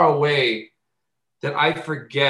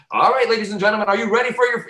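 high, projected show introduction; stage announcer; 8 semitones higher, faster than usual. Acted. Announcer welcoming the audience before a set, mock stage call. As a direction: hype up the crowd like an MC.

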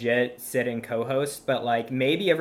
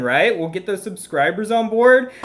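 normal talking voice

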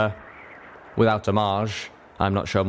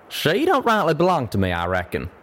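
higher-pitched folksy Western character; rustic country voice, pitched up at first; drawling cowpony; 7 semitones higher than usual. Acted.

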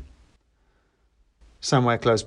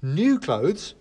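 put-on higher kid voice; know-it-all teen; boy voice; 7 semitones higher than usual. A narrator's reading. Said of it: "said the boy".

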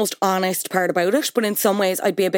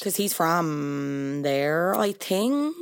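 slow, soft, drawn-out uncertainty; hesitant guess; 3 semitones lower, 5 dB quieter, much slower than usual. Real, not acted. Co-host guessing where the rapper is from, slow and unsure. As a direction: stretch the words while checking you're right.

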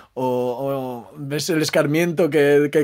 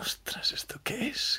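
whispering; 10 semitones higher, 11 dB quieter, slower than usual. Retold or imitated. Retold sudden surprised thought.

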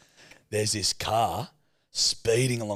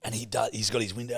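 ordinary talk

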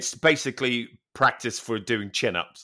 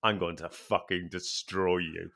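low menacing threat; 7 semitones lower, 9 dB quieter than usual. Retold or imitated.

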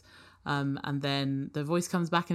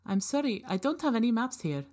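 polite robot; 7 semitones higher than usual. Acted. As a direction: answer like a helpful but clueless robot. Robot apologizes.

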